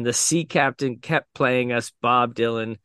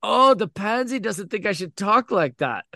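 imitating a jeering little boy, pitched up; mocking taunt; 6 semitones higher than usual. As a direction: mimic him in a higher, sing-song tease. Boy mockingly echoes the teller.

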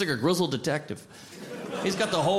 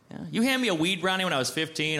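ordinary delivery

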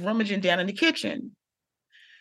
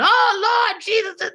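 startled cry; 11 semitones higher, 4 dB louder, slower than usual. Retold or imitated. Grandfather startled at the door.

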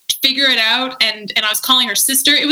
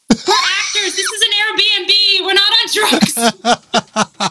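Dio's flustered plea; 9 semitones higher, much slower than usual. Retold or imitated. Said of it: "forget Dio just being like".

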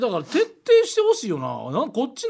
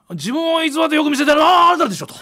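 hammed-up accusing punchline; Yurioka Chotokkyu; 8 semitones higher, 5 dB louder than usual. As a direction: stretch あなた into a mock accusation. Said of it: "ゆりおか超特急さん、".

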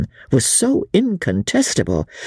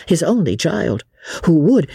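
ordinary delivery

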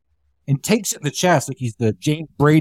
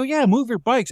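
high annoyed snap; 8 semitones higher than usual. Retold or imitated. Speaker snapping back at guy.

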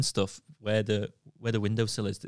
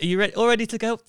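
higher, louder bright instructor voice; a cheerful instructor checking in; chirpy instructor; 12 semitones higher, 5 dB louder, faster than usual. Retold or imitated. The driving instructor asking the stung learner, mock chirpy.

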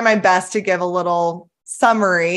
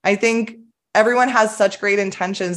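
usual speaking voice

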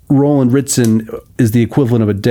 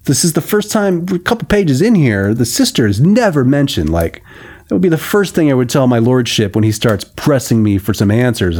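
usual speaking voice

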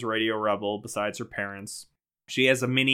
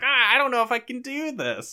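high, anxious fretting voice; nervous; 12 semitones higher than usual. Retold or imitated. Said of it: "So she's like".

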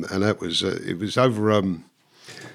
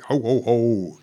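lightly voiced Santa laugh; a jokey robber's Santa ho ho ho; mock Santa; much slower than usual. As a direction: robber in Santa hat mocking bank staff. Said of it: "giving it all that".

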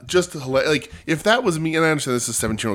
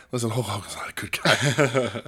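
whispered aside; 4 dB quieter than usual. Real, not acted. Aside correcting fans about Hogan.

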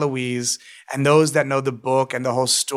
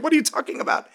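much higher, fast, mock-outraged squeal; indignant protest; 13 semitones higher, faster than usual. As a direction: squeal it in disbelief, fast and very high. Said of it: "I'm like".

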